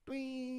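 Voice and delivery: high, thin, long-drawn whine; drawn-out cartoon falling noise; imitated falling whistle. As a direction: sound out a long plummet, high and soft.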